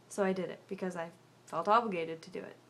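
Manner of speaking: ordinary talk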